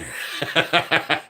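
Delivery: chuckling laugh. Real, not acted. Fan teasing viewers who left, amused laugh. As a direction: laugh to yourself.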